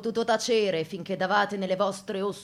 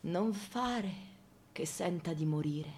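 ordinary delivery

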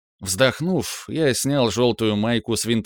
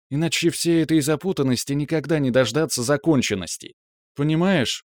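put-on raised, gentle girlfriend voice; young woman, tender and earnest; 5 semitones higher than usual. A narrator's reading. Girlfriend comforting her upset man, tenderly.

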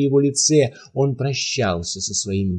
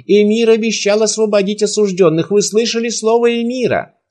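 rally the crowd with the emir's promise; shouting to the crowd; 9 semitones higher than usual. A narrator's reading. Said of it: "он кричал". (громкий призыв к толпе, выше и громче)

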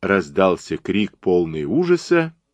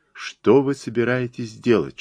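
ordinary delivery

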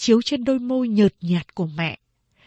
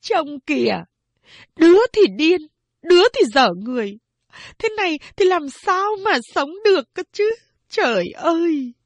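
grumbling lament; 8 semitones higher, 4 dB louder than usual. A narrator's reading. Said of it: "Mẹ tôi lầu bầu".

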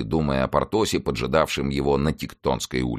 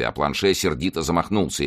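usual speaking voice

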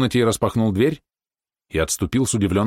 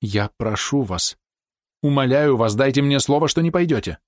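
insistent pleading; 5 semitones higher than usual. A narrator's reading. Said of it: "она настойчиво продолжила".

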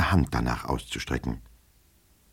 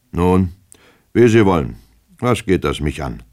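firm tone; 3 semitones higher, 6 dB louder than usual. A narrator's reading. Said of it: "in bestimmtem Ton".

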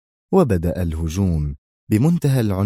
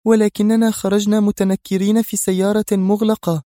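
high-pitched surprised objection; astonished; 12 semitones higher, 4 dB louder than usual. A narrator's reading. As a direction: protest in astonishment. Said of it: "قالت ريهام في دهشة".

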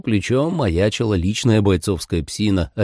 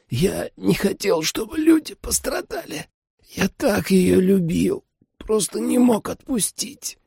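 high, slow, anguished voice; dying man's confession; 12 semitones higher than usual. A narrator's reading. Dying father confessing to his daughter, weak and remorseful. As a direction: plead weakly through pain, drawing words out.

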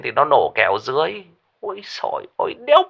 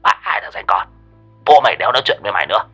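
cursing rant; 2 semitones higher than usual. Acted. Lão Lý cursing both friends on phone, building anger. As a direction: curse them out and hang up. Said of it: "văng tục, chửi hai thằng".

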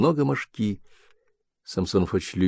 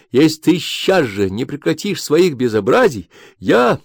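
higher, rising threat; shrill threat; 6 semitones higher, 3 dB louder than usual. Acted. Wife threatening husband, rising higher. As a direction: build to a break.

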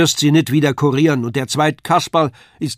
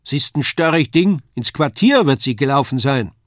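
raised, higher dialect voice; calling back; 4 semitones higher, 6 dB louder than usual. A narrator's reading. Wife complains of the stubborn girl, calling back. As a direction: call it back across the empty hall. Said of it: "rief diese wieder".

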